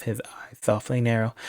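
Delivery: usual speaking voice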